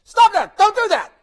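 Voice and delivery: high, loud scolding shout; retold owner's shout. Owner scolding the barking dog, high and loud. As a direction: snap at the dog to stop. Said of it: "looks at the dog and says".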